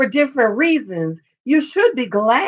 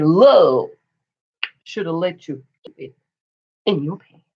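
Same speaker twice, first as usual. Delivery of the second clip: sassy comeback; 5 semitones lower than usual. Retold or imitated. Wife's cutting comeback.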